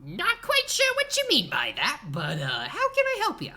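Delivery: put-on very high, loud old man voice; squeaky elderly alchemist greeting a customer; old shopkeeper. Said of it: "you're met by an old man".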